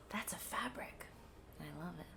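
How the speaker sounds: hushed delighted whisper after a gasp. Real, not acted. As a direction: breathe it out in awe. Gasp at the fabric pun.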